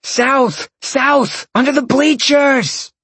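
high, urgent shout; calling out. A narrator's reading. Guy in the car directing the searchers, urgent high call. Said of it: "the guy in the car call".

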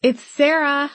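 calling out, hand over the phone; muffled shout away from phone. A narrator's reading. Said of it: "called out in a muffled voice".

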